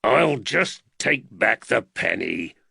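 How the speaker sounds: slow, growled threat; menacing constable's growl. A narrator's reading. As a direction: growl it slowly at the boy. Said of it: "Woods growled".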